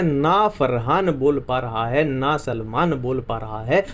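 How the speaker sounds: slow, deliberate recitation of the trick; slow teacher's recap of the mnemonic; slow repetition. Shown as a demonstration. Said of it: "एक बार फिर से कर लेते हैं".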